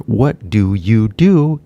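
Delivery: "What do you do" said slowly with every word clear; slow full form. Shown as a demonstration. Teacher shows the careful form first. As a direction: pronounce each word separately. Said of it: "equal to".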